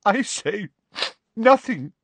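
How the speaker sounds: high, choked Trelawney; swallowing tears. A narrator's reading. Trelawney to Parvati, high and choked. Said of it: "she choked".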